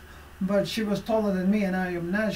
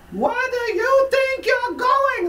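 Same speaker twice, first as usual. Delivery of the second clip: high shrill mock scream at a coworker; high piercing yell, acting out her boss; retold scream; 6 dB louder, slower than usual. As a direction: scream it like the angry supervisor. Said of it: "Like, really screaming at me".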